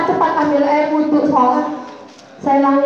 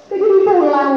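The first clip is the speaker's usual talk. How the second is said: put-on higher, pleading child's voice; imitating crying child; 6 semitones higher than usual. Homesick child begging mother on the phone, pleading. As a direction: beg to come home like a tearful child. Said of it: "Pernah telepon itu nangis".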